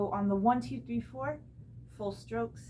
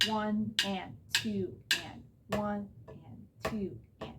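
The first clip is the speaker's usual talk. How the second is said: counting out loud; slower than usual. Shown as a demonstration. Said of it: "starting this with the click".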